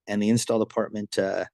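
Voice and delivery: ordinary talk, nothing marked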